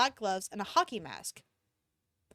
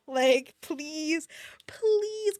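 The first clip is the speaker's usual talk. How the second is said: begging; 4 semitones higher, much slower than usual. Real, not acted. Begging author.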